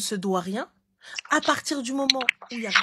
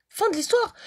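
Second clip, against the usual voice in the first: emphatic; 11 semitones higher than usual. Real, not acted. Ending the story, emphatic.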